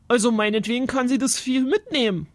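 mildly grumbled concession; a touch of grumpiness; grumbling. A narrator's reading. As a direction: give in grudgingly, as a grumpy uncle. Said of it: "brummte Onkel Berthold".

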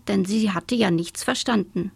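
ordinary delivery, nothing marked

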